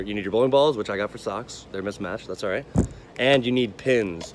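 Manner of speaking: ordinary delivery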